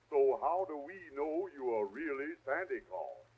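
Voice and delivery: usual speaking voice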